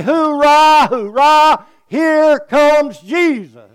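high, loud, slow cheering call; joyful shout. Pretend. Preacher urging the church to cheer Jesus, loud high shout. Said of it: "stand up and say".

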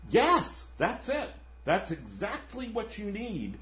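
lightly voiced saint, keen to agree; eager saint. Redeemed murderer urging the ghost to accept charity, mildly eager. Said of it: "the guy's like".

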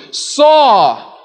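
"Saw" lifted high and loud as one stressed word; emphasized saw. Shown as a demonstration. Preacher stresses the Hebrew word order: "Saw" punched high. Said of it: "is emphasized by placing it at the beginning".

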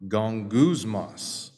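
"Gongusmos" stretched out slowly, softly; slow model pronunciation. Shown as a demonstration. Preacher leading congregation in repeating, "Gongusmos" drawn out slowly. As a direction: lead the room through "Gongusmos", low and unhurried. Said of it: "Say that with me".

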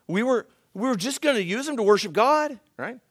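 high pleading excuse-maker; whiny excuse. Retold or imitated. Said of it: "He makes some excuses for himself".

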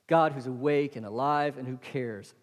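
ordinary delivery

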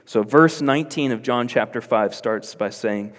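ordinary talk, nothing marked

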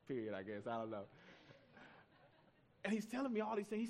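normal talking voice

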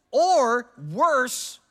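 loud, high burst of mock horror; mock outrage. Real, not acted. Preacher teasing the congregation over toilet rolls, mock outrage. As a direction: ham up the outrage at a petty crime. Joking about toilet roll peeves.

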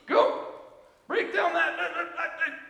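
drill sergeant yell. Retold or imitated. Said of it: "By the drill sergeant in the army".

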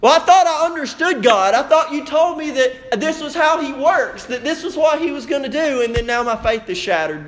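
high-pitched, hurt protest of a let-down follower; dismayed believer. Acted. Voices a disillusioned believer.

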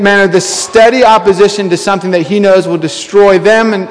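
ordinary talk

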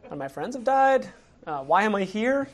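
a touch of a worn-out soldier, puzzled why he's here; understated battle-weary reply; weary soldier Uriah. Retold or imitated. Uriah answers the king.

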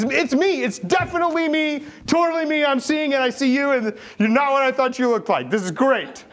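high, loud, thrilled exclamations; healed man bursting with joy; excited. Acted. Healed man telling the crowd, high and thrilled. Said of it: "And he's super excited".

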